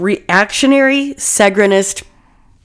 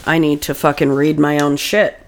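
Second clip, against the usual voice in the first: ordinary talk, nothing marked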